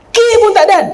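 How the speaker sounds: quick blurt as she leaves; mock hasty wife. Preacher mimics wife leaving before husband replies, rushing.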